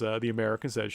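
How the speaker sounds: ordinary talk, nothing marked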